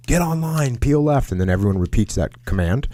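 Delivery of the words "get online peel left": retold yell. Leader relaying the order to pinned-down troops, mock yell. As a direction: call it out high like a command, without real volume. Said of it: "he just yells out".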